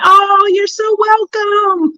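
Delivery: high, drawn-out, sweet reply to thanks; gushing welcome. Real, not acted. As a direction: lilt it slowly up high for a friend. Answers a viewer's thanks.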